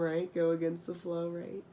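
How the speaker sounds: ordinary delivery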